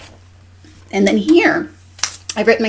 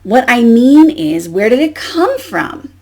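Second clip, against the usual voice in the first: slow, louder, drawn-out question; exasperated storybook duck; 4 semitones higher, 5 dB louder, slower than usual. Acted. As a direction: stretch it out, impatient, for young listeners. Duck's exasperated question read with expression.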